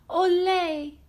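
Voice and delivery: high, loud cheering cry; excited fan's shout. Acted. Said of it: "cried Mia".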